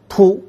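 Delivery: 突 said as a crisp tongue-tip "tu"; single-tongue syllable. Shown as a demonstration. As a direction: snap the tongue off the teeth for 突. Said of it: "我们在口腔里发的音是". (清楚地念出舌尖音"突")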